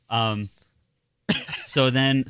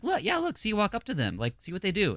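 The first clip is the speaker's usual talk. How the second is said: reassuring mom; 8 semitones higher, 5 dB quieter than usual. Retold or imitated. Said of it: "And I'm like".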